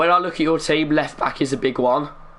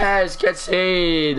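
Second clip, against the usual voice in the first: high, drawn-out but held-back cheer; restrained celebration; 6 semitones higher, much slower than usual. Real, not acted. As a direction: celebrate without really letting loose. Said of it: "I don't sound too enthusiastic 'cause I can't talk too much".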